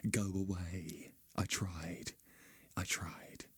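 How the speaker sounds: slow, soft whisper. Retold or imitated. Whispered rejection.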